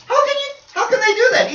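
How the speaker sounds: very high, loud acted outcry; exasperated disbelief. Preacher baffled by listeners who ignore the lesson, incredulous. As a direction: squeal it in baffled protest.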